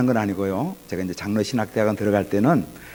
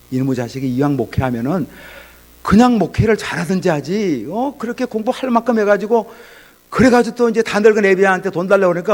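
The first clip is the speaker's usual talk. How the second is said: raised, higher-pitched scolding in a father's voice; angry father; 7 semitones higher, 4 dB louder than usual. Retold or imitated. Father scolding his pastor son for asking for money, angry. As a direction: act out an exasperated parent's rebuke. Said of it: "아버지가 화를 펑 내더라고요".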